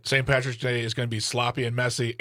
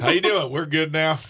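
put-on Southern accent, a touch louder; 3 semitones higher, 4 dB louder than usual. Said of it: "I sound like a Southern person".

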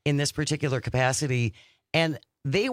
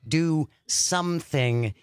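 "Do something" drawn out at half pace; slow emphasis; much slower than usual. Real, not acted. Host urges exhausted listeners to "Do something", slowly and emphatically. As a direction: stretch "Do something", a beat on each word.

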